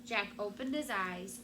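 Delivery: ordinary delivery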